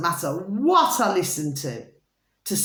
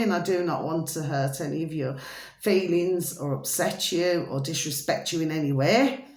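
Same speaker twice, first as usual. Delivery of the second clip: normal talking voice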